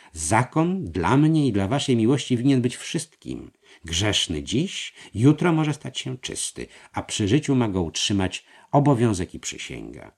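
voiced treasurer. A narrator's reading. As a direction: coax him into agreeing. Treasurer argues. (podskarbi przekonuje mistrza)

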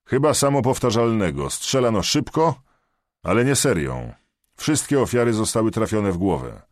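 loud, high, out of breath; loud and breathless. A narrator's reading. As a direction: bark your name into the phone, winded. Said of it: "lecz głośny i zdyszany".

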